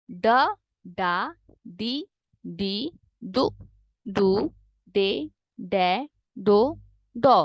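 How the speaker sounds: slow, drawn-out drill of the syllables; slow teacher drill. Shown as a demonstration. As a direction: sound out each syllable slowly for the class. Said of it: "हम slow बोलते हैं".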